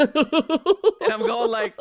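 laughing high while talking; words squeezed out through laughter. Real, not acted. Laughing while telling story.

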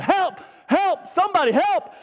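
high-pitched mock screaming; hammed-up, high calls for rescue; retold yell for help. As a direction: yell it like a trapped kid, played up. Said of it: "So I'm screaming and yelling".

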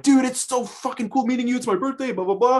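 put-on way up high, gushing like a starstruck kid. Young skater gushing to his idol's cousin, squeaky starstruck excitement. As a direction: gush like you just met your hero.

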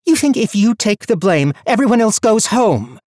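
high, loud furious tirade; wild rage. A narrator's reading. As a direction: rage at him, breathless. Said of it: "Her breathing was wild".

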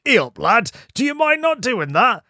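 broad northern English voice, high and chatty; a cheery northern local telling you off; northern accent. Retold or imitated. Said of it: "everyone responds to you being like".

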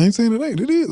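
squeaky-high protest; voice high as hell. Real, not acted. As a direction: argue back with the voice shooting way up. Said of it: "our voices go high as hell".

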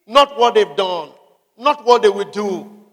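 ordinary talk

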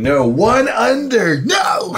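loud, high cry of dismay at the dice; frustrated shout. Real, not acted. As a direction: yell at the roll just missed. Player groans at near-miss roll.

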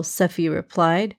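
ordinary delivery, nothing marked

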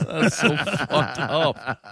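slow, laughing disbelief. Real, not acted. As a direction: drag it out through a laugh. Laughing at the copyright strike.

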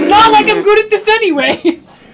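loud, high frustrated yell; exasperated shout. Real, not acted. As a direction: throw up your hands and shout it. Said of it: "shouted take".

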